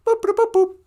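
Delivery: very high robotic boop boop; chirpy mock computer beeps. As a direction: beep like a little computer.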